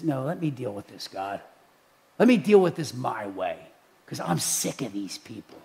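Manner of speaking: very low, soft acted grumble; weary muttering. Voicing Moses, sick of the people.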